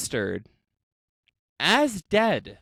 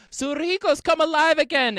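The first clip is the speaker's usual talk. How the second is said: mock yell; 10 semitones higher, 4 dB louder, faster than usual. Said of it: "she started yelling".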